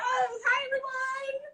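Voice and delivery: high, bright hello to everyone; excited greeting. Real, not acted. Greeting the live audience.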